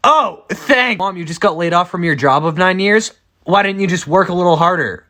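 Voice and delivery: high sarcastic jab back at mom; mock-grateful, then needle her.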